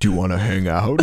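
low creepy invitation; creepy David Blaine. Acted. Lonely David Blaine asking a stranger out, low and creepy. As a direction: ask it low, with creepy neediness. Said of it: "Yeah, in his creepy voice".

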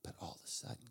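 whispered "all of a sudden" with a slight rise. Acted. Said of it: "you're supposed to be really quiet".

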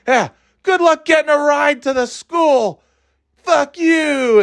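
very high mock screech of a mother; hammed-up angry shout. Mother leaves daughter behind in the car, mock shouting.

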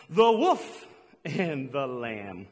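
"wolf" said low and very slowly; slow emphatic correction. Shown as a demonstration. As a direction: draw out "the wolf and the lamb" to drive it home. Correcting the Bible verse.

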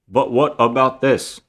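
slow, loud, deliberate interjection; imitating overdubbed soldier. Overdubbed soldier speaking up in the meeting, imitated. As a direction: say it slowly, like a badly dubbed line. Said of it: "sounds like he's overdubbed".

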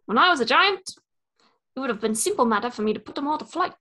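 put-on light New Zealand Korg accent; relaxed Korg storytelling, New Zealand accent. Friend guessing game, easygoing Korg in a New Zealand accent. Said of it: "trying to be Korg from Thor Ragnarok".

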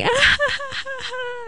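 very high forced nervous laugh, fading soft at the end; polite strained laugh to dodge the question; awkward fake laugh. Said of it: "And I was like".

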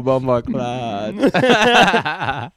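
mock slang, drawn out; drawn-out joke. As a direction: savor each syllable, teasing. Joking about island slang.

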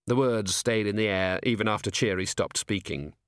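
ordinary delivery, nothing marked

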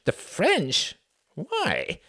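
high, incredulous questioning; surprised echo. Acted. Sam echoing Cuthbert's answer in high disbelief. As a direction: throw the words back in disbelief.

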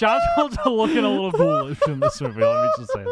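laughing while talking. Real, not acted. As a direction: giggle through the words. Laughing through lines.

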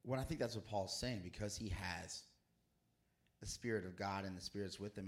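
ordinary talk, nothing marked

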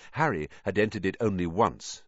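ordinary delivery

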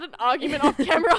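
loud laughing chatter. Real, not acted. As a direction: talk through laughter. Laughing after off-camera argument.

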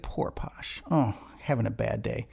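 imitating a friend's pitying whisper; breathy mock-consoling whisper, no voice; whispered sympathy. Imitating Lola whispering pity to her friend.

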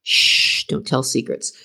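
whispered hush; shushing. Acted. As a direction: hush them playfully. Playful hushing of listeners.

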